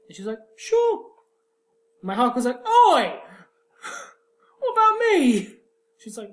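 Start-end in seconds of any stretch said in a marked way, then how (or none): 0.6-1.0 very high, loud chirpy sure; breezy high sure. Retold or imitated. Isabella chirping yes to a tryst, very high and loud. 2.7-5.6 loud high indignant oi; laughing protest. Retold or imitated. Hawke loudly protesting being left out, high and laughing.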